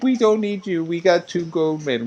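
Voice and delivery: high squeaky robot voice. Pretend. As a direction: beep like a toy robot. Silly voiced bit among friends.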